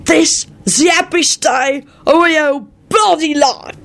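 high, slow, staggering proclamation; drunken shout. A narrator's reading. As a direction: proclaim it loosely, drawing out each word. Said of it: "staggered up to the table and shouted".

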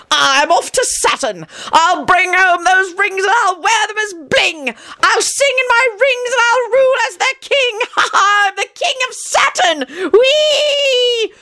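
very high, slow, giddy near-shrieking; giddy euphoria. A narrator's reading. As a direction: float away in mad delight. John singsong glee.

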